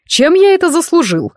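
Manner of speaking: melodramatic, pitched high; theatrical. A narrator's reading. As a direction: wail it like a stage tragedian. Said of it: "театрально воскликнул он".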